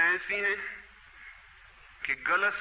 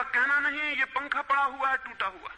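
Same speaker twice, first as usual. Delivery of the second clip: angry king; 6 semitones higher than usual. Acted. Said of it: "उन्होंने कहा".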